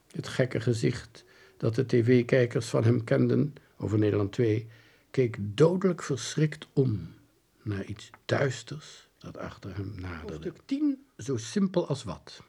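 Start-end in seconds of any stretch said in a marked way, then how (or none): none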